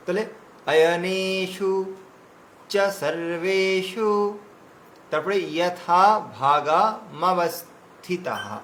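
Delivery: ordinary talk, nothing marked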